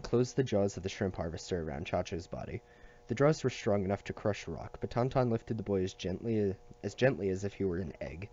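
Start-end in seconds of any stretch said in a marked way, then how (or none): none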